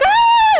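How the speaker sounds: very high, loud shriek of fright; startled scream. Retold or imitated. Hippie couple scared by a child in the snow, very high startled scream. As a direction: shriek as if ambushed. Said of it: "they were like".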